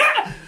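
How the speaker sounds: very high yelp of fright; mock terrified squeal; startled scream. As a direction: shriek like you just got jumped. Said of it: "And he's like".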